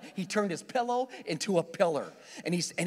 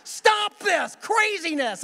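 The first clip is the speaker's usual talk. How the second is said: much higher, louder slow yell; inner shout; 11 semitones higher, 6 dB louder, much slower than usual. Retold or imitated. Driver silently screaming at the cop holding the breathalyzer. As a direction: yell it in your head. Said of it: "I'm thinking to myself".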